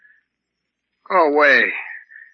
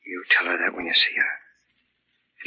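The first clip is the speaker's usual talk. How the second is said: slow delirious whisper; 8 semitones lower, 4 dB quieter, slower than usual. Acted. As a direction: breathe it out weakly like a sick man. Delirious man pleading to nurse.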